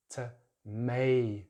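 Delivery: slow "tomato" as tuh-MAY-toh; "tomato" in syllables with ay; American pronunciation. Shown as a demonstration. Teacher shows American vowel, slow "tomato" with MAY. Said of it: "American English, they have a slightly different".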